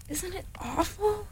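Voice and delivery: high, hushed and scared; a small voice trembling with fear; frightened Piglet. Acted.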